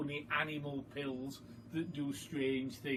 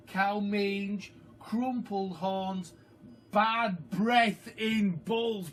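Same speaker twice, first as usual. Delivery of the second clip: very slow, louder and higher; label read out; 5 semitones higher, 5 dB louder, slower than usual. A narrator's reading. Reader reading the horse pill label, very slow and grand. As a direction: stretch each word out like a proclamation.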